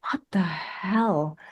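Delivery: breathless whispered disbelief, slow; stunned whisper. Retold or imitated. Medium whispering at her table in stunned disbelief. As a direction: hush it out in shock.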